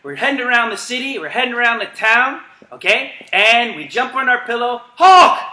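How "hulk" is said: high, loud shout; called-out command. Real, not acted. Coach calling the Hulk command: big excited shout. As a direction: bark it out to the class.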